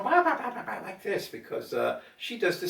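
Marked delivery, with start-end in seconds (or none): 0.0-0.8 higher imitated bah-bah scoffing; mock bah bah. Retelling the Spanish coworker's scoffing, higher mock bahs. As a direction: mimic her dismissive bah noises. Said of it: "she starts going".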